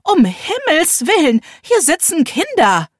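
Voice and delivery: put-on high, loud, scandalised old woman; shocked elderly lady crying out; old lady exclaiming. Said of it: "rief eine alte Dame".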